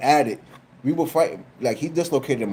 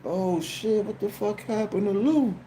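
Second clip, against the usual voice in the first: higher, softer nosy onlooker voice; gossiping neighbors; 7 semitones higher, 5 dB quieter than usual. Retold or imitated. Neighbors seeing his arm in the air, gasping in higher voices. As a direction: play the shocked neighbors gawking. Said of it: "everybody looking at me like".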